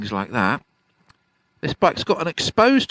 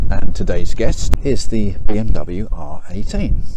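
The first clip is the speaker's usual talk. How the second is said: presenter intro; 7 dB louder than usual. Acted. Walk-around presenter intro.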